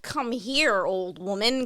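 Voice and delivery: low, slow taunting murmur; creepy taunting. Retold or imitated. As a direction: coax her in like a menacing stalker. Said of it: "he fucking taunts her".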